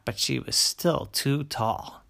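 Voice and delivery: plain reading voice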